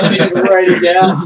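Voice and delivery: high, loud mocking imitation; loud mocking player voice. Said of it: "He's like".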